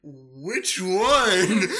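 loud, high retold disbelief; mock bewilderment. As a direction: act out dad's baffled outburst, laughing. Dad cannot find the wrecked car.

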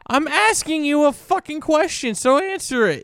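high, loud mock snap; fake-furious demand; mock angry.

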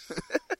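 squeaky burst of laughter; high laugh. Real, not acted. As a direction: let out a high giggle. Laughs at own joke.

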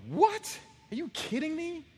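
high-pitched incredulous yelp; shocked disbelief. Acted. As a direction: react as if handed an impossible job. Acted shocked passenger asked to fly.

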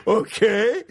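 high, questioning lift; acted wary acceptance of a strange call; puzzled compliance. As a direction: go up at the end, unsure. Drunk, handed a mystery phone call.

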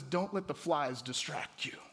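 low, soft, slow counsel; God speaking. Retold or imitated. God answering the agitated man at prayer, calm and low. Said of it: "God says".

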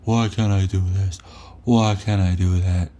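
mock the listener's moaning; complaining impression. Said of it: "all you do is fucking complain".

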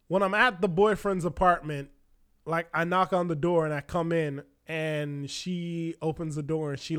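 ordinary delivery

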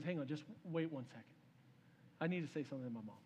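low, soft and grave; hushed, solemn request; quiet interruption. Acted. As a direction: halt them gently, voice dropped. King halts his mother's whipping.

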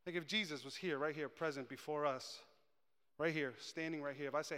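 ordinary talk, nothing marked

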